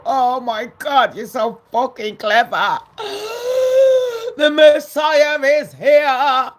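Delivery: loud, drawn-out fake awe with a high gasp; mock adoration. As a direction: gush like a worshipper, building to a mocking shout. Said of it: "bow down to you and go".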